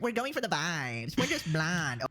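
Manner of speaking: slow, high-pitched ditzy twang; mock bimbo voice. Co-host imitating the voice she can't stand, mocking bimbo twang. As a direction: drag it out in a put-on bimbo twang. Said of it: "can't stand that voice? Like".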